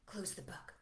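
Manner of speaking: soft hushed whisper; hushed storytime whisper; gasp and whisper. Acted. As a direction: whisper like it's a secret. Secretive whisper.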